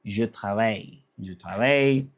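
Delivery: "Je travaille" as zhuh trah-vai; French pronunciation. Shown as a demonstration. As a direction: demonstrate French je travaille, soft zh in je. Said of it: "that's how I say I'm working".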